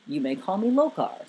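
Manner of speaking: low, dignified giant; Morgan Freeman-like. A narrator's reading. Giant introducing himself to a visitor, deep and dignified. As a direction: introduce yourself in a deep, stately voice. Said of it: "still sounding all Morgan Freeman-like".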